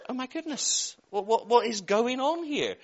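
high, amazed gasp of oh my goodness; astonished. Retold or imitated. Retelling the shock of meeting Auntie Anne on the beach, amazed. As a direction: act stunned that she is really there.

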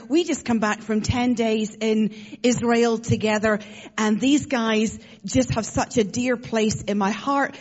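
ordinary talk

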